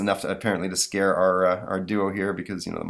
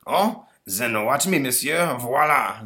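put-on smug French accent; 5 semitones higher, 3 dB louder, slower than usual. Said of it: "says the ghost".

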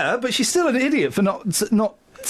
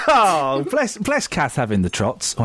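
normal talking voice

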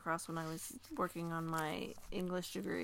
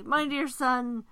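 slow, a little higher and louder, halting; dying lady's words; 4 semitones higher, 7 dB louder, much slower than usual. Acted. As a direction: let the dying lady's words falter out slowly. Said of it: "and so broke off a little".

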